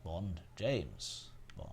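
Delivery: low, soft suave Bond delivery; Bond line. Retold or imitated. Streamer doing Bond in Roger Moore voice. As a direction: purr it like Bond. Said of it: "I'm working on my Roger Moore impression".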